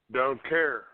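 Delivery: normal talking voice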